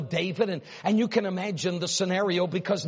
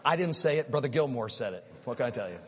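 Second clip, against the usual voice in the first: ordinary talk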